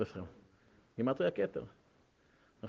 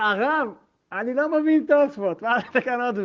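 high, loud disbelieving student; incredulous student; 9 semitones higher, 9 dB louder than usual. Retold or imitated. Student protesting to the rebbe he envies, loud and high in disbelief. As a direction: cry out that it makes no sense.

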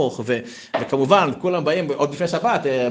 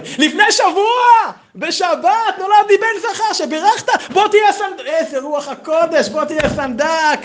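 much higher and louder, thrilled voice; overjoyed community head; 8 dB louder than usual. Acted. Community head thanking him for his son, thrilled and high. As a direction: gush with delight, way up high. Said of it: "ראש הקהל מנשק לו את הרגליים".

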